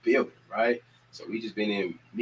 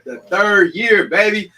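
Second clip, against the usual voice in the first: high, loud and stretched out slowly; hyped up; 9 semitones higher, 9 dB louder, much slower than usual. Real, not acted. Host hypes the third annual holiday drive to viewers, drawn out and loud. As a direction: sell it like a hype man.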